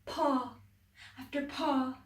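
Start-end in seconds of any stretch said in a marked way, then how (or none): none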